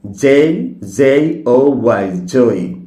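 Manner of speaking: জে ও ওয়াই spelled slowly, then জয় said clearly; slow spelling, then the word জয়; correct pronunciation. Shown as a demonstration. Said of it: "সঠিক উচ্চারণ".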